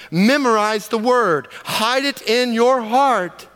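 high, slow, emphatic preaching at full force; fervent preaching. Acted. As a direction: drive each command home to the congregation. Preaching the word with fervor.

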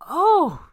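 sharp high gasping oh. A narrator's reading. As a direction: gasp it out suddenly. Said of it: "she gasped".

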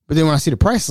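normal talking voice

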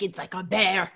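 put-on failed Scottish accent. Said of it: "I can't do a Scottish accent".

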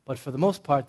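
ordinary delivery

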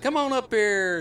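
high, hearty call to come up; excited invitation. Retold or imitated. Host calling fans up for a picture, excited invitation.

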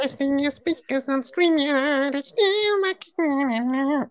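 high squeaky chipmunk singing, slow at the end. Pretend. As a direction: sing like a sped-up chipmunk. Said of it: "like this".

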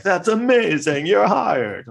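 high, slow hammed-up approval; mock Evans. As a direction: overplay an instant yes. Mocking Evans instant approval.

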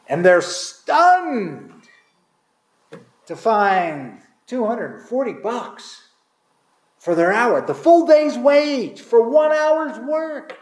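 slow, drawn-out amazement, rising high on the last words; stunned. A narrator's reading. Preacher telling how the late workers gasp at their pay. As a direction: let the disbelief stretch out slowly.

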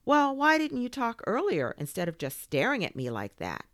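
high, loud indignant questioning; affronted kid's complaint. Acted. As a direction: demand an explanation, voice up. Boy challenges the toy.

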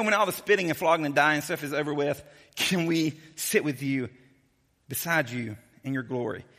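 ordinary talk

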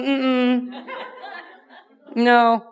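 amused mm-mm through a laugh; playful laughing refusal. Pretend. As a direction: chuckle it out.

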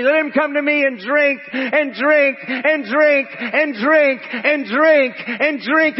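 high, insistent preacher's cry. Acted. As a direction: keep calling out the invitation, drumming in each repeat. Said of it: "he cried out".

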